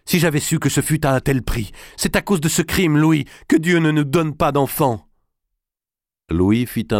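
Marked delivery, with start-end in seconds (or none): 0.0-5.1 a queen's indignant outcry; exclaiming. Acted. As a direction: cry out in shocked protest. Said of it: "s'écria Clémence".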